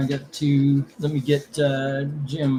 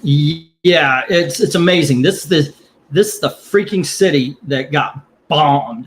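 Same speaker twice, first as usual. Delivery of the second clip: louder, angry outburst about the city; real anger, pushing volume up; heated rant; 3 semitones higher, 5 dB louder than usual. Real, not acted. Ranting about voters and 9/11.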